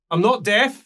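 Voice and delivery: lightly voiced indignant old dwarf; gruff dwarf woman. Old dwarf woman snapping at a cheeky adventurer.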